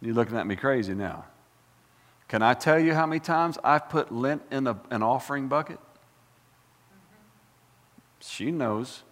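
ordinary talk, nothing marked

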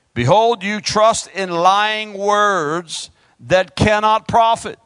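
slow, weighty proclamation; solemn scripture reading. Acted. Preacher reading Jeremiah's verse to the church, slow and grave. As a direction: stretch each word like a prophet's warning.

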